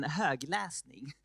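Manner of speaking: högläsning said slowly in Swedish; Swedish word. Shown as a demonstration. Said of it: "as we say here in Sweden".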